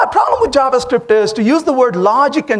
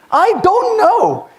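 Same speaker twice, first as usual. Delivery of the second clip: high, slow, helpless whine to mom; 7 semitones higher, much slower than usual. Retold or imitated. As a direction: drag it out like a lost new parent. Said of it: "you call mom and say".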